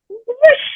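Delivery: put-on a high, quick whoosh for the racing boy; whoosh sound. Telling how her son shot off with the basket, a high whoosh. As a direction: sweep the sound up high like something zooming past.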